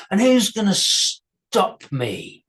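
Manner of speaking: a lightly voiced troll, teasing slowly. A narrator's reading. Troll taunting the girl who refused him, slow mock. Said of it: "troll taunts the girl".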